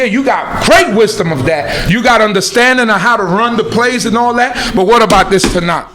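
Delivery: normal talking voice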